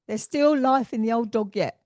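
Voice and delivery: loud, emphatic proclamation; blunt relayed quip. Retold or imitated. Medium relays a husband's saying to his wife, loudly. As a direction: belt it out as his cheeky saying. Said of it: "that's the way he put it to me".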